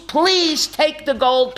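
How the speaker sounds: high, drawn-out imitated plea; pleading. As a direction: stretch out "please take the gold" as begging. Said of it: "I beg of you".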